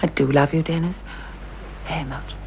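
soft, low declaration of love; quiet affectionate reassurance; tender. Acted. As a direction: say it gently, voice sinking away. Tender declaration to her husband.

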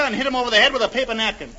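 ordinary talk

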